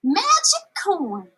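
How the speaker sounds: slow, high and proud; girl grinning at Ma, drawn out; with a grin. Acted. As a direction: savor the words with delight. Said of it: "she said with a grin".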